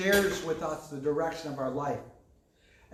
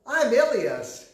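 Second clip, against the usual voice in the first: put-on higher, slow, grand kid announcement; boy announcing himself as a friend from Europe; imitating his son; 7 semitones higher, 5 dB louder, slower than usual. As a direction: proudly present the new friend. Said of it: "He goes".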